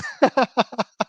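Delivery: a quick laugh, well above speaking pitch; high laugh. Real, not acted. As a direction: burst out laughing at the joke. Laughs at own joke.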